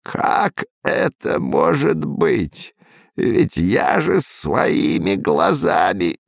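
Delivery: put-on slow, strained old man's voice; a shaken old man, slow and hushed. A narrator's reading.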